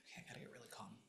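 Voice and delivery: hushed, breathy whisper to a spirit; a quiet medium's murmur; whispered séance. Acted. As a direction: whisper as if sensing someone near.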